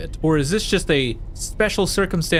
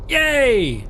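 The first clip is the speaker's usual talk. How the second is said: excited yay; 7 semitones higher, 3 dB louder than usual. Real, not acted. Celebrating success.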